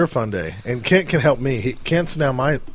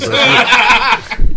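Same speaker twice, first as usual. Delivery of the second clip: laughing; 10 semitones higher, 6 dB louder, much slower than usual. Real, not acted. Laughing mid-story.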